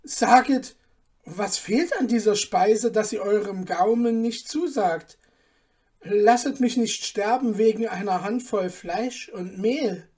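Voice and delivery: a small cook begging on his knees; frightened plea; pleading dwarf. Acted.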